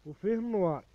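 loud market call listing the colours; loud hawking. Acted. Vendor calling the available colours to shoppers, loud. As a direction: project it over the crowd.

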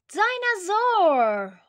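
high, loud, drawn-out storybook exclamation; excited exclamation. A narrator's reading. Boy guessing what the huge turtle is, excited exclamation. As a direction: slowly stretch the word out in wonder.

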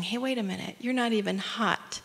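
soft, puzzled discovery; hushed surprise. Acted. Men in the furnace touching each other, hushed surprise. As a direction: say it softly, just noticing something odd. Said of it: "touching each other, saying".

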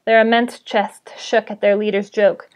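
ordinary delivery, nothing marked

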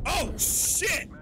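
high startled yelp of Oh, shit. Real, not acted. Player ambushed by an enemy, startled high yelp. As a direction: jump at the sudden threat. Sudden enemy attack in game.

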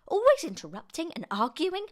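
high, loud complaint; cried out. A narrator's reading. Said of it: "she cried".

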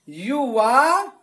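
युवा drawn out very slowly; stretched recitation. Shown as a demonstration. Teacher spelling out युवा slowly for students. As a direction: pull out युवा syllable by syllable. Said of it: "एक अलिफ के बराबर खींच कर पढ़ेंगे".

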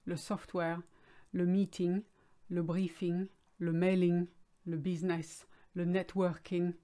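English business words in a French accent; French accent throughout. Shown as a demonstration. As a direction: list them the way the French say them, French accent. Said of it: "with a French accent again".

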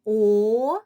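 long loud О, pause, then СЕЛ; О-сел, broken after a drawn-out О; syllable by syllable. Shown as a demonstration. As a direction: say О-сел in two separate beats. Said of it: "скільки складів у цьому слові".

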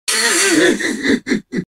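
high descending villain cackle; evil cackle. Acted. Villain hunting a fleeing foe, high falling cackle. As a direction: start high and cackle downward. Villain laughs during battle.

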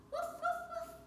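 high playful woof woof; dog barking. Pretend. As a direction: bark like a happy puppy. Playing a dog in yoga.

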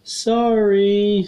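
high, loud, stretched-out apology; hammed-up clown voice saying sorry; apologizing clown. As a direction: wail the apology comically high. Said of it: "of course, apologize".

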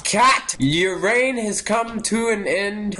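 high, theatrical pronouncement; dramatic proclamation. Pretend. Gamer confronts the guilty cat, grandly declaiming. Proclaiming judgement on game suspect.

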